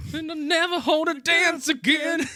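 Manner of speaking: loud high indignant cry; hammed-up yell; mock shout. Mock reply in movie recap.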